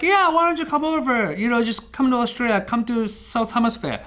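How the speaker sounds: high, upbeat imitation of his friend; friend inviting him. Friend in Sydney inviting him over, breezy and high. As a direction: raise your voice into a breezy Aussie friend. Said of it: "she goes like".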